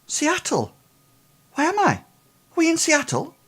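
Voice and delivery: put-on very high-pitched, confused boy; high voice of a bewildered lad; disoriented boy. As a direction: ask it high and lost. Disoriented boy asks where he is.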